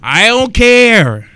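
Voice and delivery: loud, slow, high I don't care; fed up and done with excuses; emphatic declaration. Real, not acted. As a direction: slam it down word by word. Blunt dismissal mid rant.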